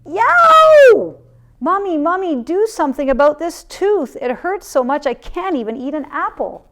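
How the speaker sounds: high, loud yelp of pain; yelled. A narrator's reading. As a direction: cry out from a sore tooth. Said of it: "and yelled".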